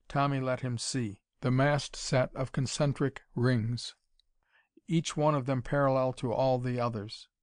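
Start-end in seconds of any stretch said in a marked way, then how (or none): none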